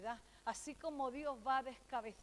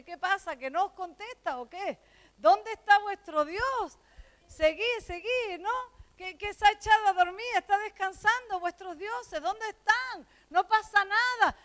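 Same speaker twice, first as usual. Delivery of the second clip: loud, high taunting jeer at the false prophets; mocking shout; 7 semitones higher, 14 dB louder than usual. Elijah taunting the prophets of Baal, loud mocking shout. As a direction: ridicule them at full voice.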